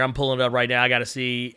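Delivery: usual speaking voice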